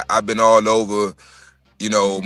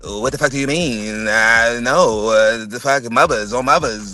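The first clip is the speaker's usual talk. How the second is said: lightly put-on sassy girl voice; imitating Baltimore girl. Host mimicking how Baltimore women talk, mild mocking put-on voice. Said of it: "Be like".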